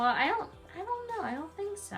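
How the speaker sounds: plain reading voice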